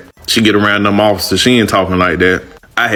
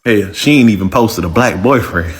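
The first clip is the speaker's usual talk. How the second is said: ordinary talk, nothing marked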